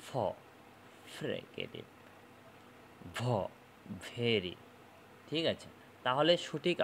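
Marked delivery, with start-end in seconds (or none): 0.1-1.8 "ফ", then "ফ্রিকেটিভ" with lip-on-teeth f; isolated "ফ", then low, soft "ফ্রিকেটিভ" with the same f; labiodental f demo. Shown as a demonstration. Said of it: "এরপর বলো". 3.2-4.5 "ভ", then "ভেরি" with buzzing v; labiodental v demo. Shown as a demonstration. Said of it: "এরপর বলো".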